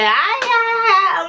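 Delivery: high, drawn-out mock wail; retold yelling. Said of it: "And she just yelling the whole time".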